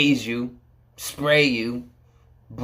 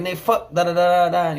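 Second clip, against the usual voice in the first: higher mock tirade trailing into filler; retold cussing out; 6 semitones higher than usual. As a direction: sketch the cussing, pitched up. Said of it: "cussed him out".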